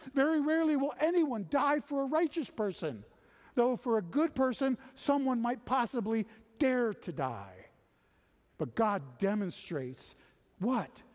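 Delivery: high, loud, driving sermon voice; full-force preaching. Acted. Preacher urging the congregation that God moves first, full force.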